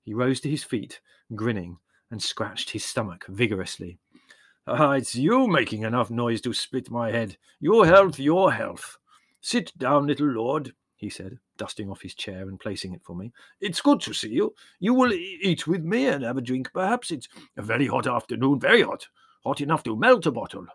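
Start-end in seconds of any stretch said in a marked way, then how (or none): 4.7-10.8 higher, louder character voice; lively rustic Greek shepherd; old shepherd, hearty. Acted. Old shepherd's dialogue in narration. 13.6-20.7 higher, louder character voice; lively rustic Greek shepherd; old shepherd, hearty. Acted. Old shepherd's dialogue in narration.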